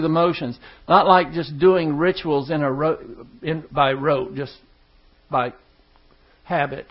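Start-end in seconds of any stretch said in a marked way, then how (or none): none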